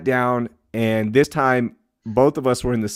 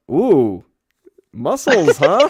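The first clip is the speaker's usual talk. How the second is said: lightly voiced flirting lady; flirty nurse; 3 semitones higher, 5 dB louder, much slower than usual. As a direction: tease him like an admiring nurse. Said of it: "she goes".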